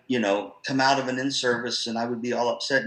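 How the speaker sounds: usual speaking voice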